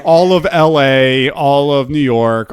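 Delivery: slow, loud roll call; loud name-dropping. Real, not acted. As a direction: call out each place loudly, stretched out. Answering heckles to drop names.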